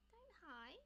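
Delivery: soft, high coaxing plea. Real, not acted. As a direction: gently beg the pet not to hide. Coaxing a shy pet on stream.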